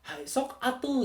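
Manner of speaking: high, lilting Sundanese invitation; Sundanese accent. Shown as a demonstration. Showing how a Sundanese person would urge someone on, lilting Sundanese accent. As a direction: swing it upward like a Sundanese speaker. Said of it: "itu orang Sunda banget tuh".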